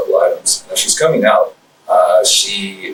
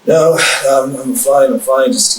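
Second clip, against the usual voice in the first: a hint of a shaken woman brushing off help, higher; upset woman, lightly voiced; 5 semitones higher, slower than usual. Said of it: "And she's like".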